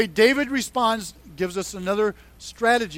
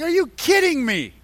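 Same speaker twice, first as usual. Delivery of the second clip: mock indignation, pitched up; higher-pitched retold disbelief; angry Goliath; 5 semitones higher than usual. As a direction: act out an affronted giant without shouting. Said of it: "Goliath is angry".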